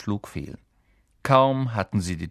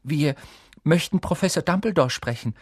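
higher-pitched, boldly stated request; a touch of daring; rather bold; 7 semitones higher than usual. A narrator's reading. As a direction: speak up bravely to a teacher. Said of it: "sagte Hermine recht kühn".

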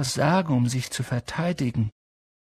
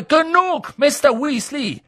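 high, loud reprimand; stern professor; 5 dB louder than usual. A narrator's reading. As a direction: shut the protest down firmly. Professor cuts off pupil's protest. (scharfe, hohe Zurechtweisung)

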